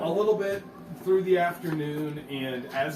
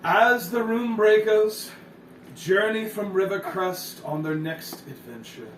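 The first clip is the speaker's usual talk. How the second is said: pompous narrator voice; 7 semitones higher, 4 dB louder than usual. Acted. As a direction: announce it like a self-important chronicler. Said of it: "He says".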